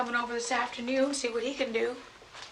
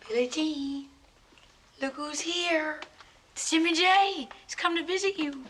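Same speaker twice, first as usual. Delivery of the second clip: higher, cheery coaxing voice; sweetly upbeat, pitch lifted; bright coaxing; 4 semitones higher than usual. Acted. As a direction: coax a silent patient with cheerful news. Coaxing silent Billie Jean to respond.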